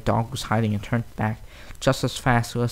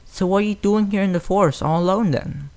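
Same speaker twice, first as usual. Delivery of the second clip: suspicious; 8 semitones higher than usual. A narrator's reading. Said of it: "asked suspiciously".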